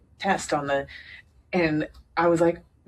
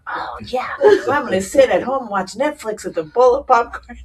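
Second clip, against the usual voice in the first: higher, louder mock enthusiasm; sarcastic eagerness; 7 semitones higher, 7 dB louder than usual. Joking to herself about a night in, with mock glee. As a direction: relish it with irony. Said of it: "And I thought".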